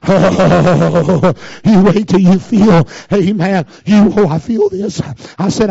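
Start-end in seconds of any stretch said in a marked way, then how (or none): none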